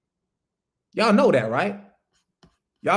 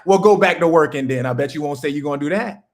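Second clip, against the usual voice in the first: ordinary delivery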